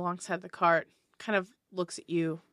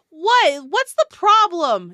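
much higher, louder woman's voice; female dwarf driver; 11 semitones higher, 11 dB louder, slower than usual. Acted. Driver answers the walking dwarf, higher and louder. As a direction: snap back from the driver's seat. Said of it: "it's like".

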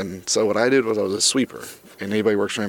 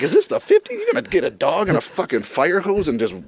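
comic disbelief, pitched way up; indignant; 6 semitones higher than usual. Retold or imitated. Driver reacting to the dog threat, indignant.

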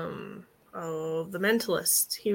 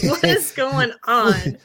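drawn-out, louder, higher question; mock bewilderment; 4 semitones higher, 6 dB louder, slower than usual. Co-host voicing reluctant Blue Beetle in photo, mock bewilderment. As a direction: stretch it out in comic disbelief.

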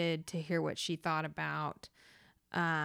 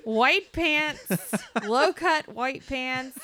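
loud, high, laughing through the words; slow emphatic description breaking into laughter; real laughing disbelief; 7 semitones higher, 7 dB louder, slower than usual. Real, not acted. Dancer telling co-host about the awful costume, laughing.

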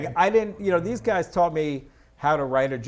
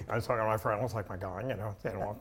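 low, soft mumbled imitation of a daughter; gurgling teen talk; 7 semitones lower, 9 dB quieter, faster than usual. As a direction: run the words together like a gurgling stomach. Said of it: "it's like a gurgling stomach".

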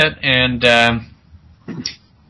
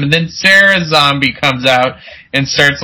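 ordinary talk, nothing marked

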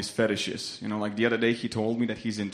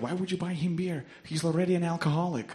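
imitated angry woman; 6 semitones higher, 3 dB quieter than usual. Woman challenging the man who bought beer, mock angry. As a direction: scold in a raised pitch, lightly imitating her. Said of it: "she got so angry".

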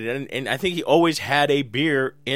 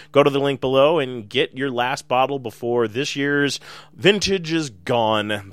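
ordinary talk

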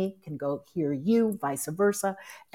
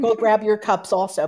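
louder call-out; 4 semitones higher, 8 dB louder than usual. Real, not acted. Urging listeners to grab cups.

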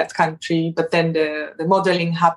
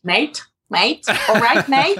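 mock Australian accent; 3 semitones higher than usual. Shown as a demonstration. Practising the host's Australian lesson, a playful mock Australian accent. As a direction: try out the Aussie "mate" for fun. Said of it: "you just need to nail Australian".

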